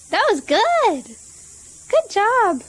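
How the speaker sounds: high, slow praise; praising a pet. Real, not acted. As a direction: cheer the bird on warmly. Praising parrot.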